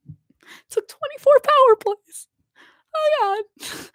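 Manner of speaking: high, drawn-out dismay; exasperated disbelief. Real, not acted. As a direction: wail the stat in disbelief. Appalled at team's power-play stat.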